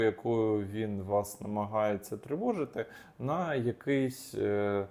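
ordinary delivery

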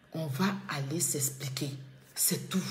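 breathy whisper, a soft warning; whispered promise to settle it in court. Real, not acted. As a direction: hush it out as a final word. Repeats threat, voice dropping away.

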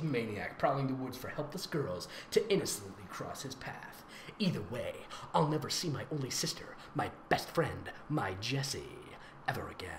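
soft, low dramatic whisper; dramatic hush. Acted. Host reading the fanfic's sad ending in a mock hush.

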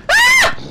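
sudden high shriek; startled scream. Real, not acted. Player screaming as the enemy strikes. Attacked again in game.

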